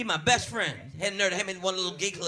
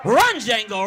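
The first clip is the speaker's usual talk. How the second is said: louder cheering call; mock cheer; 3 semitones higher, 8 dB louder, much slower than usual. Comic cheering the suspect on, loud call. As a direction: egg the runner on.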